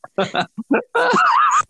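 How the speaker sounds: high wheezing laugh burst; big high cackle; loud laugh. Real, not acted. Laughing fit.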